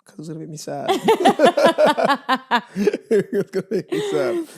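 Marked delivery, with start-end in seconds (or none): 0.9-4.5 laughing. Real, not acted. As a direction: repeat it through a high burst of laughter. Repeats own line laughing.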